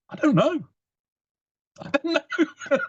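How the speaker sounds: high-pitched, flustered dad; mock panic. Dad answering his child at the theme park, high-pitched panic. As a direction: squeak it out in a panic. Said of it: "a look of panic in his eyes".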